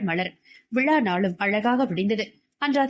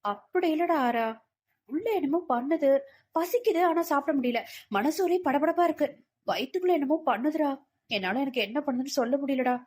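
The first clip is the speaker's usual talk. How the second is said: higher-pitched, troubled Malar figure; uneasy young woman; 5 semitones higher, 3 dB quieter than usual. Acted. Malar telling her friend she feels unwell, uneasy. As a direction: plead that something feels wrong.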